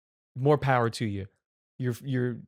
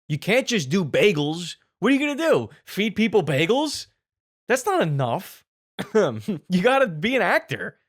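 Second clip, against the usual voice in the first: higher-pitched, incredulous comic tirade; mock indignant rant; 5 semitones higher, 4 dB louder than usual. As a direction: rant at them in exaggerated disbelief. Mock rant at bagel shop owners.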